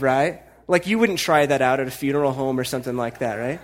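ordinary talk

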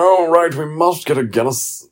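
high, eager mock-pub-goer voice; mocking punters. Pub-goer mocking St Patrick's Day drinkers, high and eager. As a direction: send up the Paddy's Day crowd. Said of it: "people are like".

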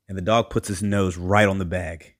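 usual speaking voice

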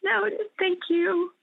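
choked up and laughing through real tears; tearful thanks between laughs; crying. Real, not acted. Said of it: "sorry, I'm crying now".

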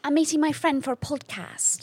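high, put-on British voice; British accent. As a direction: play at being British. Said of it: "it just sounds better".

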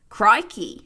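broad Australian "Crikey" with a loud falling drawl; Australian NPC catchphrase, loud; Australian accent. Acted. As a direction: play an Aussie NPC catchphrase. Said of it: "if an N P C was Australian".

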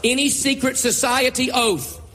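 slow, emphatic preacher's declaration; slow renunciation prayer. Acted. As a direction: declare each phrase slowly with pauses. Leads congregation in renunciation prayer.

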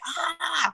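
a sudden high, soft exasperated "ah"; mock frustrated outburst. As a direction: act out losing it at your kid. Snapping at Grayson, retold.

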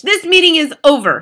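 high, loud witch's shout. A narrator's reading. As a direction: shout the meeting closed. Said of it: "she shouted".

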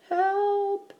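high, thin little plea; small whispered cry. A narrator's reading. Eddie hides in the basket, whispering for help. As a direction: squeak it high without raising volume. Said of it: "whispered Eddie".

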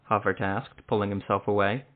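ordinary delivery

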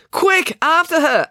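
high, loud, drawn-out command; urgent order. Acted. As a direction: order the dog to give chase. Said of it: "April ordered Pumpkin".

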